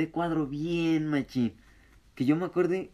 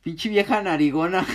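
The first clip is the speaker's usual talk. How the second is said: loud mock; 6 semitones higher, 7 dB louder than usual. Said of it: "este güey llega y dice".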